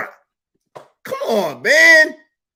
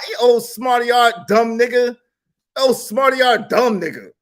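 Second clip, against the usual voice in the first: mocking taunt; 11 semitones higher than usual. Real, not acted.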